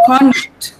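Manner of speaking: conduct as CON-duct, full o up front; first-syllable stress. Shown as a demonstration. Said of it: "the first syllable is stressed".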